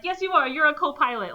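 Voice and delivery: high, emphatic mock insistence; group insisting Gordon is the co-pilot, high and emphatic; insistent. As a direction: shut down his excuse.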